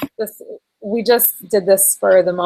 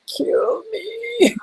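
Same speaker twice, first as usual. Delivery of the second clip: mock agony; 14 semitones higher, 3 dB quieter than usual. Sore legs tomorrow.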